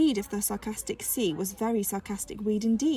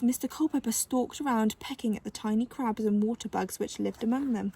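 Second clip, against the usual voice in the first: ordinary delivery, nothing marked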